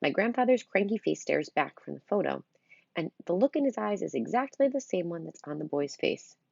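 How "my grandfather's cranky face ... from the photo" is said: plain reading voice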